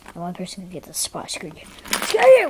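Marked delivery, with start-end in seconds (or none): 2.0-2.5 high, loud attack shout; sudden high-pitched yell; battle cry. Pretend. Calling out a play attack.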